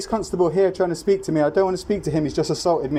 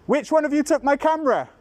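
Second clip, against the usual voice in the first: raised, insistent calls, higher pitch; yelling across the room; 8 semitones higher than usual. Real, not acted. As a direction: call out demanding across the room. Demanding camera back from officers.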